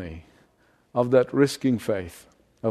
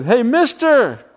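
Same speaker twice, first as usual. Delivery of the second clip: yelled out; 2 semitones higher, 3 dB louder than usual. Retold or imitated. Little boy on the bus step yelling to the old man. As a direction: call triumphantly across the street. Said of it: "he yelled out to the man".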